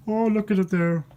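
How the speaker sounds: ordinary delivery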